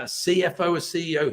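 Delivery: normal talking voice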